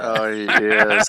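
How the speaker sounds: slow words through laughter; laughing. Real, not acted. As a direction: laugh it out slowly. Laughing agreement.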